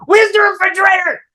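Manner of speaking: very high, loud mock-frantic cry; hammed-up emergency panic; frantic search. Man rushing yogurts through Target, mock-frantic high shout. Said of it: "And I'm going".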